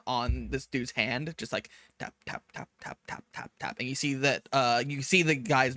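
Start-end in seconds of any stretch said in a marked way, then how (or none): 2.0-3.5 whispered tap tap; soft tapping noises. Retold or imitated. Game master mimicking the Joltik's taps, whispered. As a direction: tap it out under your breath.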